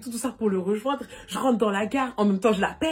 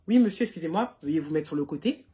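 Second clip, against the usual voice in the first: lightly voiced stern cop, low and formal; a hint of a police officer giving orders; imitating policeman; 3 semitones lower than usual. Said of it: "dans la voix qui dit".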